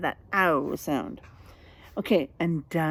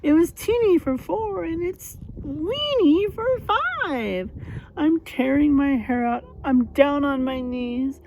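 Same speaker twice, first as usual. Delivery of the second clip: put-on high, loud despairing old lady wail; crying old lady; 6 semitones higher, 5 dB louder than usual. Old lady pleading with the wise man, desperate loud wail. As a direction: wail like a frantic old woman at her wits' end. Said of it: "The little old lady cried".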